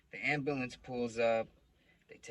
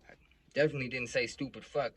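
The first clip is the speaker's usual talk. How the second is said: ordinary delivery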